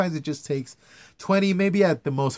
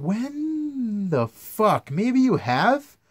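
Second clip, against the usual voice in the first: incredulous rant; 8 semitones higher, much slower than usual. Real, not acted. Ranting at annoying excuse.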